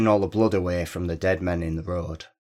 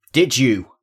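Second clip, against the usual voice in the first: pressing question, noticeably louder; a little louder; 5 semitones higher, 6 dB louder than usual. A narrator's reading. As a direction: push for an answer the second time. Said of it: "a little louder".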